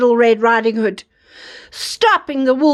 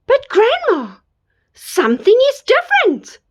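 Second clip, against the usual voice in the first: put-on little girl voice; 9 semitones higher than usual. Girl speaking to fake granny.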